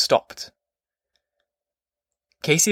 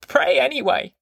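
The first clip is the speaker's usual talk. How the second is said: high-pitched granny giving an order; commanding grandmother, pitched way up; ordering grandma; 11 semitones higher than usual. A narrator's reading. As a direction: order him in a high old-woman voice. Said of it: "she ordered".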